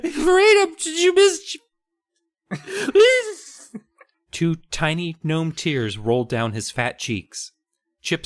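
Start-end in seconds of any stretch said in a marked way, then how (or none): 0.0-1.6 high squeaky little gnome, pleading; very high pinched gnome whine; tiny gnome begging to be set free. Acted. Reading gnome dialogue in squeaky voice. 2.5-3.7 high squeaky little gnome, pleading; very high pinched gnome whine; tiny gnome begging to be set free. Acted. Gnome begs.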